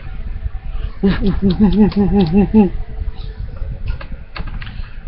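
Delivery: imitated monkey hoots, high and quick; monkey hooting. A narrator's reading. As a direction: hoot like a troop of excited apes. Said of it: "all the gorillas and monkeys started".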